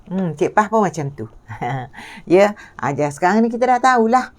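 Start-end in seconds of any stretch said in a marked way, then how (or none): none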